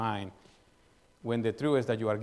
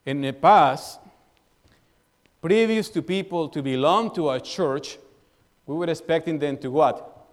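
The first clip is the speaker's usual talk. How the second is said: normal talking voice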